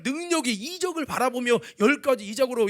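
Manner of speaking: ordinary talk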